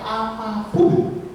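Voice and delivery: "Apapun" stretched very slowly with a rise; drawn-out emphasis. Real, not acted. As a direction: drag out "Apapun" for weight. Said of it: "stressing the word apapun". ("Apapun" diucapkan sangat lambat dan ditekan)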